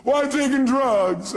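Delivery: high, slow imitation of a complaining caller; mock whining. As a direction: drag it out in a put-on whine. Voicing the caller's excuses.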